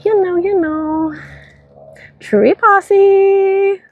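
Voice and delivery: very high goofy voice; high silly voice. Pretend. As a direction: squeak it out playfully. Silly shoutout.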